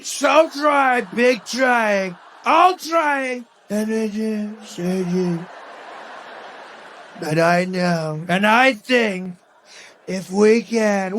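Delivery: slow speech, volume and pitch all over the place; Trump. Acted. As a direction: swell and drop erratically, stretching the words. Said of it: "The volume on his voice all over the place".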